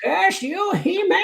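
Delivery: high pitched villain curse; Skeletor voice. Pretend. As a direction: snarl it like a foiled cartoon villain. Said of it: "Do your best Skeletor voice".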